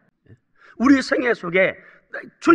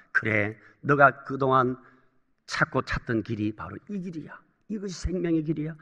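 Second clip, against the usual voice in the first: soft, low voice of guidance; gently instructing; 5 semitones lower, 10 dB quieter than usual. Acted. God's word showing a believer the path of life, gently instructing. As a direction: drop right down and counsel quietly. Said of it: "하면서 우리를 교훈으로 인도할 수 있는".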